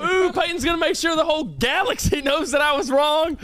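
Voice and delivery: high, teasing mock voice; mock him in a high sing-song. Mocking Peyton back.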